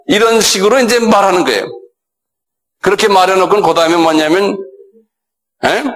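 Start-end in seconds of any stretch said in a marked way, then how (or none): none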